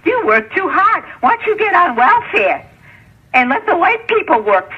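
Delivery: high-pitched mimicry; mocking retold advice. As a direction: pitch it up to mimic someone urging. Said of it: "you know what they told her".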